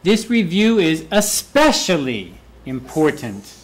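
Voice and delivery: "especially" lifted and punched, slight pause before "important"; stress on ESPECIALLY. Shown as a demonstration. Teacher highlights importance for students, stressing "especially". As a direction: make "especially" stand out from the sentence. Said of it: "make these words emphasize out".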